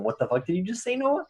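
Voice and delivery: mock outrage. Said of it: "Nobody even asks him".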